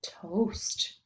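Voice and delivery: "Toast" whispered softly, with no voice; whispered word. Shown as a demonstration. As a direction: whisper the word for the children to repeat. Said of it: "Your turn".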